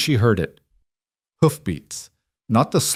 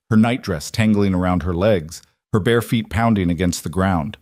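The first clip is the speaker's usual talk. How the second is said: ordinary talk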